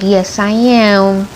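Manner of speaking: slow, drawn-out yes. Retold or imitated. Answering her husband about her videos, slow and proud. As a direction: stretch it out proudly. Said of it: "I said".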